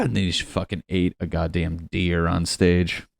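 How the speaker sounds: ordinary talk, nothing marked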